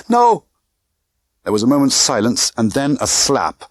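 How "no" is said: high, loud cry of refusal. A narrator's reading. Girl crying out to men in cabin, loud shriek. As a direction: shriek it out in protest. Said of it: "a girl's voice crying".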